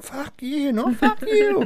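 very high squeaky yelling; mock squabbling kids. Dad imitating kids suddenly fighting, high-pitched mock fury. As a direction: squeal it like feuding little kids.

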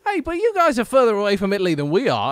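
loud, high English retort; mock English accent. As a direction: imitate an indignant Englishman, pitched high. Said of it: "having an Englishman on the show".

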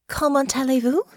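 a hopeful try at French; French phrase. Acted. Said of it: "disapproved of Honor's pronunciation".

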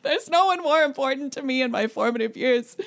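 high, loud overwrought fan voice; hammed-up devoted superfan; gushing superfan. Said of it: "Every comment is like".

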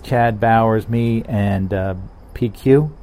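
ordinary talk

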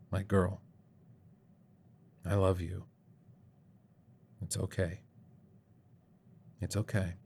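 hushed and tender, near a whisper; barely audible comforting hush; soft whisper. A narrator's reading. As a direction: soothe a dying child quietly. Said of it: "I just held you and whispered".

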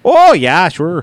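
stretched, lifted "oh" in a Minnesota accent. Shown as a demonstration. Host showing his home state's talk, Minnesota accent. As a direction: put on a broad Minnesota accent. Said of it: "I can't even do a Minnesota accent".

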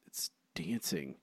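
whispered guess under his breath; muttered to self in a whisper. Real, not acted. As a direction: breathe the answer to yourself. Whispering answer to himself.

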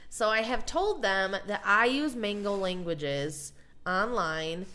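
usual speaking voice

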